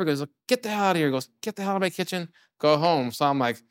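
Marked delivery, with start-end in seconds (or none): 0.5-1.1 pitched-up retold outburst; retold screaming. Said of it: "he just started screaming at me". 1.4-3.1 high-pitched mock yell at moderate volume; pitched-up retold order; retold screaming. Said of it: "he just started screaming at me".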